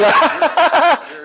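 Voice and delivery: high, rolling laughter; laughing. Acted. Listener hearing a friend claim to be God, laughing. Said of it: "And you're laughing".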